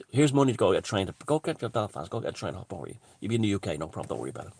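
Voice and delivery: sly quiet tip-off. Retold or imitated. Irish officials quietly slipping migrants money.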